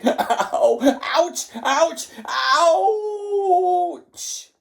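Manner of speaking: high, loud yelps ending in a long howl; yelp, building to a drawn-out wail; hammed-up howls of pain; yelling in pain. A narrator's reading. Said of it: "clutching his bottom with both hands and yelling".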